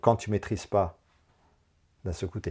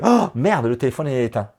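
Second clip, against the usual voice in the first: retold dismay; 3 semitones higher, 6 dB louder than usual. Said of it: "je fais".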